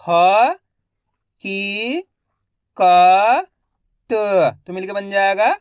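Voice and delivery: each syllable said alone, loud and clipped, then the whole word drawn out slowly; slow Hindi reading drill, syllable by syllable; phonics sounding out. Shown as a demonstration. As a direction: spell it out syllable by syllable for a child, then blend it slowly. Teacher sounds out and blends words.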